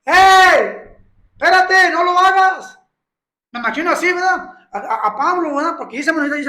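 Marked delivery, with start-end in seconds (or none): 0.0-2.8 high, loud urgent call to stop him; loud, high warning cry. Retold or imitated. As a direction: shout across the distance to stop him. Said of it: "para que haya dicho a gran voz".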